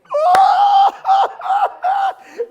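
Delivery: very loud, shrieking high laugh; mock wailing laugh. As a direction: burst into a huge, wailing cackle. Mock reaction to group's answer.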